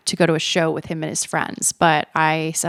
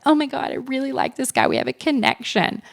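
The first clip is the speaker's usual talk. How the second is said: higher, gushing younger self; mocking her younger self; 6 semitones higher than usual. Mocking how her younger self gushed, higher gush. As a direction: parody of her smitten old self. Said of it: "I probably would have been like".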